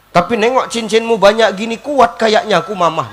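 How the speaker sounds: loud mock lament, dropping at the end; tangisan suami. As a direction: keep up the husband's fake crying while eyeing the rings. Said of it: "makin kuat nangisnya". (tangisan pura-pura yang keras)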